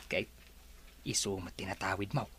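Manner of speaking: sneering aside muttered too soft to hear; muttering under breath. Acted. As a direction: say it to yourself so the boss can't catch it. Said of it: "Anya ti kunam?".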